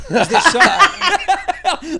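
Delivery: breaking up with laughter while talking; laughing. Real, not acted. Host riffing on co-host's release form, laughing. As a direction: start high and loud in laughter, then recover.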